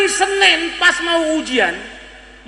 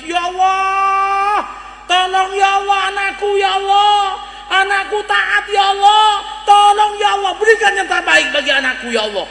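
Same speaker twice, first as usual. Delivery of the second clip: acted tearful wailing; 5 semitones higher than usual. Mother begs God for her obedient son, wailing. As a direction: wail a prayer as a weeping mother, easing down at the end. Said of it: "Ibunya langsung nangis".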